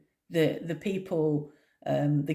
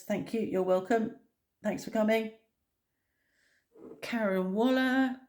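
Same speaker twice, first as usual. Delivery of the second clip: usual speaking voice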